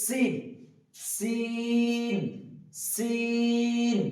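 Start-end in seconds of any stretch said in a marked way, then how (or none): 1.1-4.0 সিন sung long and slow with a hissing s; drawn-out Arabic letter sin. Shown as a demonstration. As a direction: stretch সিন into a long chanted note. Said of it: "আমার সঙ্গে সঙ্গে আপনারা বলুন". (সিন টেনে টেনে সুর করে উচ্চারণ)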